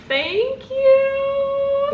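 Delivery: very high, stretched singsong squeal; delighted thanks. Real, not acted. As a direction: squeal your thanks, drawing it out. Reads congratulations, thanks the senders.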